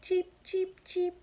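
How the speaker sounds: very high tiny cheeps; high chick peeps; baby chicks. Acted. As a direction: chirp like newborn chicks.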